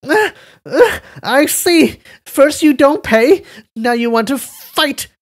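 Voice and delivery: high, loud pained shriek; screaming in pain. Acted. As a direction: wail while clutching the face. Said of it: "he began to scream".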